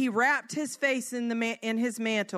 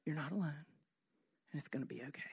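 very soft, low reassurance; hushed, tender comforting; still small voice; 7 semitones lower, 13 dB quieter, much faster than usual. Acted. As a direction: soothe gently, barely above a murmur. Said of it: "small, small voice to say".

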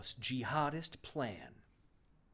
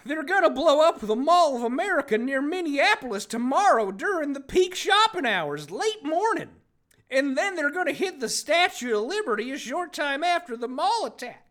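high, loud dramatic villain read; over-the-top sinister reveal, much higher and louder; hammed-up reading; 11 semitones higher, 7 dB louder than usual. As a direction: ham up the evil plan like a cartoon villain. Reading villain's plan aloud, hammed.

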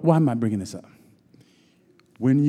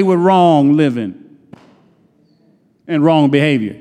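raised, insistent preaching; 5 semitones higher, 7 dB louder than usual. Acted. Preacher warning the congregation that judgment is coming, in a raised preaching voice. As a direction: drive the point home from the pulpit.

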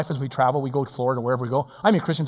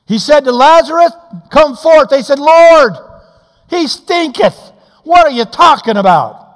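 loud, high preacher's cry; full-force revival sermon; fiery preaching; 12 semitones higher, 15 dB louder, slower than usual. Acted. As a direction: preach it out to the back pews. Preaching Lazarus story at full force.

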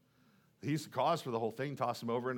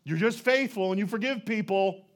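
higher, louder, sighing whine of a fed-up prophet; exasperated complaint to God; 4 semitones higher, 6 dB louder than usual. Retold or imitated. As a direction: throw up your hands and gripe at God, sigh included. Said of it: "He said to God".

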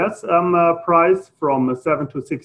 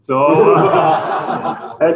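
laughing; 4 dB louder, much slower than usual. Real, not acted. Laughs about own German accent.

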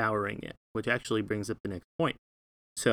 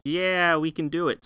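higher, louder cheer; cheering crowd; 6 semitones higher, 4 dB louder, slower than usual. Pretend. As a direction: pump them up like a crowd cheering. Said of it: "they're like".

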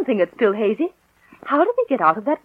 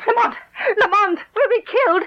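terrified cry; 7 semitones higher than usual. Acted. Trapped woman crying out in terror.

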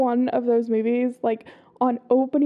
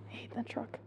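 whispering; 19 dB quieter than usual. Real, not acted. Annoyed at a loud truck.

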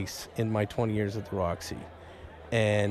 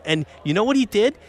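higher-pitched, eager rush; excited storytelling; 7 semitones higher than usual. Real, not acted. Fan telling co-host about the Beetlejuice walk, excited. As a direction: build up to the best part of the anecdote.

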